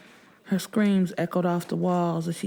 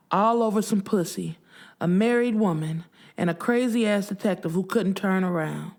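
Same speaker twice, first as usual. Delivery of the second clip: ordinary delivery, nothing marked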